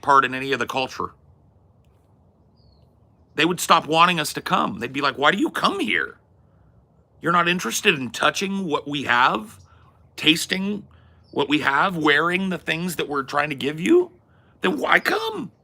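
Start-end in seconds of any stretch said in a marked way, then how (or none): 5.2-15.5 higher-pitched mock indignation; indignant questioning. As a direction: act out baffled, offended hosts challenging a visitor. Acted offended hosts questioning visitors.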